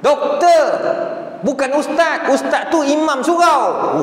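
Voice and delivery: mock a proud lecturer who has been insulted; angry correction. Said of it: "Oh, marah dia". (marah meniru pensyarah yang berlagak)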